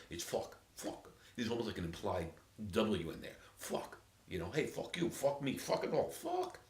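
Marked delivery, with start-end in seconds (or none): 0.2-1.1 "fuck" drawn out slowly, New York style. Shown as a demonstration. Showing how a New Yorker says "fuck". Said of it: "To say it like a New Yorker". 4.5-6.6 New York cursing. Acted. New Yorker swearing, building up.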